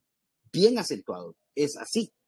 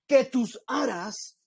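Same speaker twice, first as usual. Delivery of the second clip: declaimed; 8 semitones higher, much slower than usual. Shown as a demonstration. Preacher going on declaiming the anthem, slow and grand. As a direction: declaim it slowly, landing hard on "no". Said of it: "si usted lo quisiera declamar".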